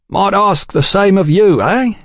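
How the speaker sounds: high-pitched cheeky retort; insolent. A narrator's reading. Taller man answering back to Oliphant, insolent. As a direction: throw the question back insolently. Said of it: "the taller man said insolently".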